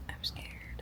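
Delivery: scared hushed whisper; whispering on the phone. Real, not acted. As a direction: whisper it, frightened and quiet. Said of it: "I have to whisper".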